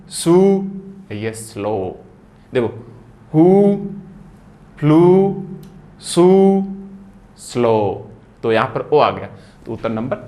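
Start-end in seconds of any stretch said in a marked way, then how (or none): 3.3-6.6 हु, फ्लू, सु each called out high and loud with long ऊ; long oo drill. Shown as a demonstration. Teacher drilling class on हु फ्लू सु long ऊ. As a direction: belt out each long ऊ word. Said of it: "यहाँ भी long sound ऊ का है".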